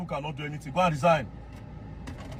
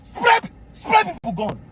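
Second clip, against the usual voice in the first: outraged shout; 10 semitones higher, slower than usual. Real, not acted. Describing gunmen attacking a church, a slow outraged shout. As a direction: drag it out in disbelief and fury.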